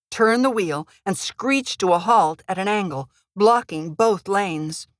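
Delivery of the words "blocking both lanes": ordinary delivery, nothing marked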